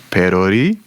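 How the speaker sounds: "perori" tacked on, swooping up at the end; imitated verbal tic. Fan mimics a character ending sentences with his tic, rising playfully. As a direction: drop in "perori" as a quirky rising catchphrase. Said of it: "So he'll just be, like, talking".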